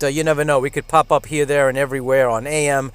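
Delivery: usual speaking voice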